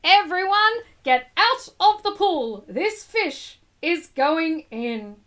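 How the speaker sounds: loud call then slow; fireman yelling. Acted. Fireman clearing the pool of swimmers, loud call. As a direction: bellow it across the pool. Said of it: "The fireman yelled".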